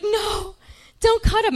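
high, loud comic wail; hammed-up desperate plea; pleading protest. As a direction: beg them in mock horror. Retold protest to paramedics.

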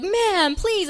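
imitated paramedic, high and urgent; acted-out insistent appeal; exasperated plea. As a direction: reason with a stubborn patient, pitched high.

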